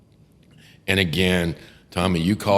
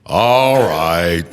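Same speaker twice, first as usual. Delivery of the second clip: louder, drawn-out unimpressed judge; judge's voice; 2 semitones lower, 7 dB louder than usual. Retold or imitated. Lawyer imitating the judge's weary reply, louder and drawn out. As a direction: let it hang like the judge. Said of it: "And he's like".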